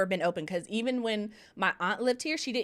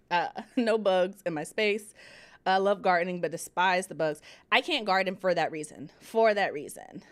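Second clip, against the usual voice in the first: normal talking voice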